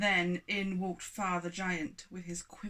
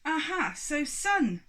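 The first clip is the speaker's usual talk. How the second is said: accusing giant; 8 semitones higher, 7 dB louder, slower than usual. Acted.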